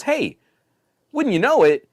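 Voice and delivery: high, bright eager computer voice; chirpy helpful assistant; eager helper voice. Retold or imitated. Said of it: "and it goes".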